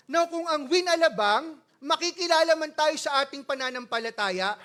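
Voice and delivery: loud, fervent sermon delivery; preaching. Acted. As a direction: preach it out to the congregation. Pastor preaching at full force. (nangangaral nang malakas)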